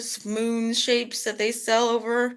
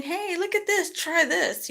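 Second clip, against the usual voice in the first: cheerful offer; 11 semitones higher than usual. Retold or imitated. Cheerful offer, much higher.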